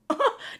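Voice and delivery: high burst of laughter; mocking laugh. Real, not acted. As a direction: laugh in her face. Laughs at Meredith.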